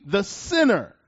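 "the sinner" pressed down with a falling tune; emphasis on sinner. Shown as a demonstration. Preacher reading scripture, emphasising "the sinner". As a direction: set "the sinner" apart with weight. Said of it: "that's my emphasis".

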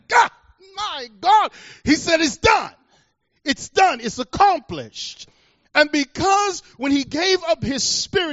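loud, high-pitched, climactic proclamation; full-force preaching. Real, not acted. Preacher proclaims the resurrection at the sermon's climax, shouting. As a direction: thunder it out high to the congregation.

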